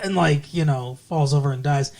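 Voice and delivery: ordinary talk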